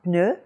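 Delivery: "pneu" with almost no air on the P; unpuffed French P. Shown as a demonstration. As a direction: model "pneu" rising, P straight into N. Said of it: "puff of air has practically gone away".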